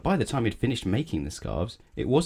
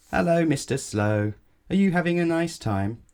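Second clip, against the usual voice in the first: higher, louder call across the road; friendly shout; 10 semitones higher, 4 dB louder than usual. Acted. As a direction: call out cheerfully to the driver. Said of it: "you shout to him".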